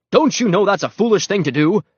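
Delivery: loud, high scolding question; demanding. A narrator's reading. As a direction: demand it as you pull him away. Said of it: "he demanded".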